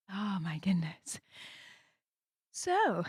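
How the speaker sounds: usual speaking voice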